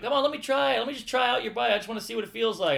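put-on high, coaxing teen bully, quick at the end; wheedling bully voice. Teen bully coaxing a scared kid for his bike, high wheedling voice.

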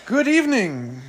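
booming ringmaster welcome. Acted. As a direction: announce it like a circus host. Mock ringmaster show opening.

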